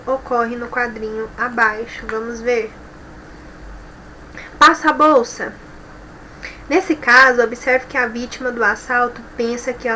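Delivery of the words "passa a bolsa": a firm, commanding mugger's demand. Shown as a demonstration. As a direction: say it firmly, like a threat. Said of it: "pela voz firme do menino".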